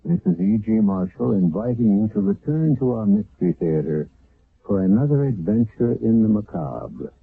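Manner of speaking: normal talking voice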